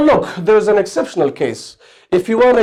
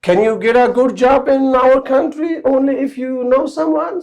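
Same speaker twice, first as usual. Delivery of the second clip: high, rising question reading; "Can you get a good job" asked with a high rising tune; 8 semitones higher than usual. Shown as a demonstration. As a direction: read it out as a real question, pitched up. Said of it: "this way it becomes interrogative sentence".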